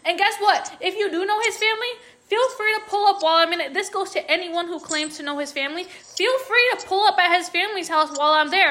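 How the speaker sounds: daring them to step up, heated; confrontational challenge. Real, not acted. Creator calls out people who claim to know his family, confrontational.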